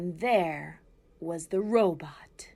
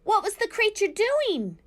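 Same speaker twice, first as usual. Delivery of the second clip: much higher, loud anxious weasel; 8 dB louder than usual. A narrator's reading. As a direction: squeak it out alarmed. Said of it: "said Dart the weasel".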